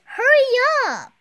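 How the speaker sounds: loud high urgent call. Acted. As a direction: shout it across the museum. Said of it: "Greg calls".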